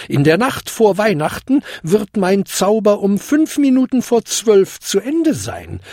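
high, solemn fairy godmother; cautioning old lady, pitched high; warning. A narrator's reading. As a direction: lay down the condition emphatically. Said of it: "warnte sie".

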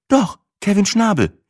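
put-on high, casual girlfriend voice; Sina's voice. A narrator's reading. Sina answering her boyfriend, high casual voice. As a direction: toss the name off lightly.

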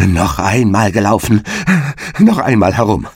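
a frantic, panicked shout; fearful cry. A narrator's reading. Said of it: "ganz außer sich vor Furcht und schrie". (Noch einmal gelaufen, ängstlich und panisch geschrien)

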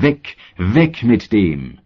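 slow, drawn-out outcry, not a full shout; narrated crowd cry. A narrator's reading. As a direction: stretch the words into a crowd's call. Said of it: "Sie schrien aber". (gedehnter, gemäßigter Aufschrei der Menge)

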